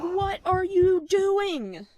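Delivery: very high, drawn-out mock dismay; appalled disbelief. Niece to aunt digging through trash, high appalled wail. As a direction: stretch every word in exaggerated disbelief.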